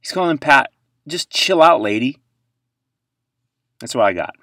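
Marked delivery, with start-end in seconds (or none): 0.0-2.2 louder, higher impatient retort; mock scolding. Host retorting to Mahomes' mom, mildly loud annoyance. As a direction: tell her to relax, annoyed. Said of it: "that's why I was like".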